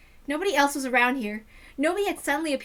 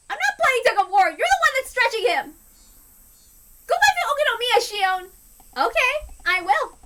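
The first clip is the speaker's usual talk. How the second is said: rising, louder quarrel, higher; sisters bickering; 6 semitones higher, 4 dB louder than usual. Acted. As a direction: snipe back harder each line. Twin sisters bickering over Keiichi.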